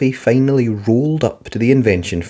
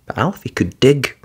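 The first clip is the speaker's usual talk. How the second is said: ordinary delivery, nothing marked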